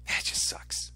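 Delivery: breathy whisper, almost no voice. Real, not acted. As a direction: let it slip out as a whisper. Whispered then repeated aloud.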